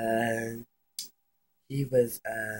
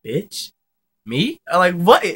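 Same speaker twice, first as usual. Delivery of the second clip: retold disbelief; 9 semitones higher, 11 dB louder, slower than usual. Acted shocked questions in retelling.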